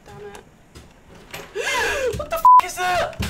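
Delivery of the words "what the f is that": high, loud frightened outburst; gasp, then shout in alarm; panicking gamer. Real, not acted. Monster appears in game.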